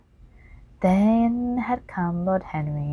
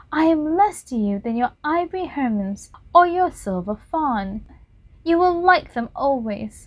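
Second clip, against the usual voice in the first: put-on higher, anguished young man's lament; raised, bitter young voice; despairing Dorian; 7 semitones higher than usual. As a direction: grieve for lost youth.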